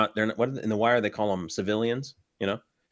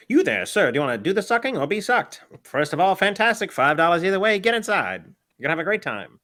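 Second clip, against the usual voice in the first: high, loud carnival barker pitch; 9 semitones higher, 4 dB louder than usual. Acted. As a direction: sell it like a fairground barker. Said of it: "That's the pitch".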